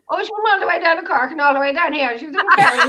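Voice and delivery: high, loud Irish country gabble with laughter; mock rural Irish voice; mimicked Irish country accent. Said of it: "He goes".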